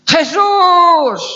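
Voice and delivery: very high, long-held yell; desperate shout. Acted. Blind man calling Jesus as he passes, desperate shout. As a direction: scream out to be heard over a crowd. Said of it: "A gritar".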